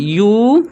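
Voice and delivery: teacher isolating the "yoo" sound in "यू"; isolated opening sound. Shown as a demonstration. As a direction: sustain "यू" as a long "yoo". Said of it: "इसे हम लोग यूरोपियन पढ़ते हैं".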